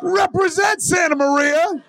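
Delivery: high, slow rallying yell; hype shout. Pretend. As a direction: shout it for the home team. Mock rumble chant.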